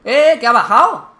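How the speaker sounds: sudden loud cry of surprise; startled shout. Real, not acted. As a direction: jump and yell in shock. Enemy suddenly comes down.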